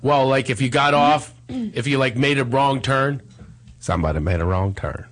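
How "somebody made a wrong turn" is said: Morgan Freeman voice. Pretend. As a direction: lower calm narrator voice, lightly done. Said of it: "it should be Morgan Freeman".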